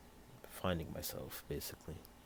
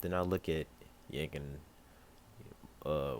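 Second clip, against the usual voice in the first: plain reading voice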